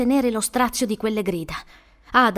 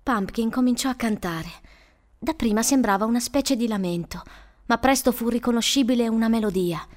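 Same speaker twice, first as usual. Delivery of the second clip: plain reading voice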